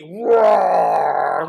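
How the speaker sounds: loud, slow roaring words; cranky roar. Acted. Reader roars with the children, loud and slow. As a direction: growl it out like a grumpy bear. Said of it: "Do a roar with me".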